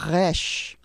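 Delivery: Resh with a rolled throaty r from the back of the throat; guttural Resh. Shown as a demonstration. Teacher modelling guttural Resh for learners. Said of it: "a word which starts with".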